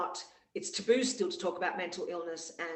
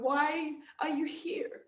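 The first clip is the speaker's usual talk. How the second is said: higher, slow, strange inner voice asking why; nagging ego voice; 8 semitones higher, slower than usual. Retold or imitated. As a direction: voice the doubting ego, drawn out. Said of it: "but my ego's still going".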